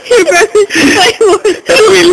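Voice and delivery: slow, crying with laughter; laughing. Real, not acted. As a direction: wheeze it out through laughter. Laughing fit, can't breathe.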